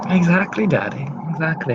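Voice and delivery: put-on high, sugary daddy's girl; sweet daughter voice. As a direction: gush up high to please Daddy. Daughter answers father on phone.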